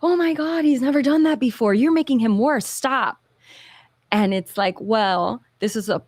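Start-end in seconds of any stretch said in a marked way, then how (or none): 0.0-3.2 high, fast panicky parent blurting it out; imitating a frantic mom, pitched way up; mock-panicked parent scolding the therapist; alarmed parent. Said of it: "They're like".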